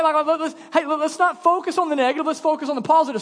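high, loud, hammed-up upbeat voice; mock a preachy look-on-the-bright-side Jesus; mock positive Jesus. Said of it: "Some of you think Jesus is that way".

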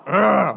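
mock sleeper's growly grunt; imitated grumpy groan. Pastor imitates his sleeping wife's noises, grumpy grunt. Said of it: "seriously, she makes those noises sometimes".

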